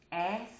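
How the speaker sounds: "Ask" said slowly with a clear final k; careful ask. Shown as a demonstration. Vlogger modeling the right "Ask" for viewers. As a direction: land the k in "Ask". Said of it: "maybe that's a tip".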